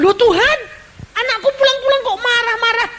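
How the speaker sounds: high, loud startled protest; upset complaint. Acted. Believer protests to God about possessed child, high and dismayed.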